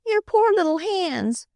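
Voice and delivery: high, near-tearful pity; almost cry over his hands. A narrator's reading. Sister near tears over the blistered hands. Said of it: "Flossie almost cried".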